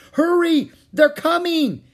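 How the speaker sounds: very high, loud warning yell; alarmed shout. Acted. Annie to Jack as pirates approach, alarmed shout. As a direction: scream for them to hurry.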